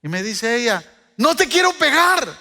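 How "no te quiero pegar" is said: high refusal. Retold or imitated. Girl refuses to hit him.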